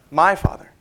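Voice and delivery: "My" lifted and set apart, slight rise; stress on MY. Shown as a demonstration. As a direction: lean on "My Father" with a small lift. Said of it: "This doesn't sound so strange to us".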